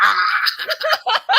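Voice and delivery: high, loud comic "ah"; short put-on scream through laughter; mock scream. Him acting out his reaction to congratulations, mock scream.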